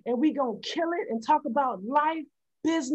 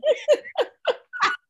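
squealing laugh up high. Real, not acted. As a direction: laugh excitedly. Laughs after intro.

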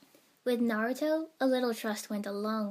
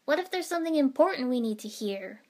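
loud, higher-pitched telling-off; raised, reproachful voice; scolding; 5 semitones higher, 7 dB louder than usual. A narrator's reading. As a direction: boss them off, exasperated. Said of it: "Ino scolded".

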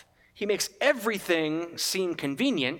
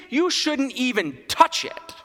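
"touch it" raised and slowed; stress on touch; 7 semitones higher, 4 dB louder, slower than usual. Shown as a demonstration. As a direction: lean on "touch it", higher. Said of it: "do we ever hear the word "touch it".

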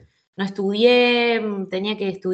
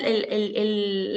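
ordinary talk

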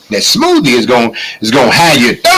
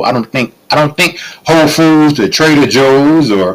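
ordinary talk, nothing marked

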